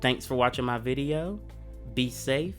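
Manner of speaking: ordinary talk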